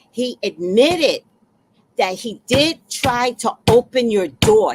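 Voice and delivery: ordinary talk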